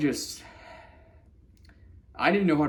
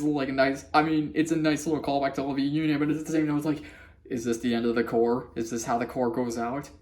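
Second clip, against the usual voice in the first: ordinary talk, nothing marked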